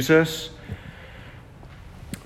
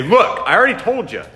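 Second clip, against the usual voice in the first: impatient retort; 8 semitones higher, 5 dB louder than usual. Pretend. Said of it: "He doesn't say".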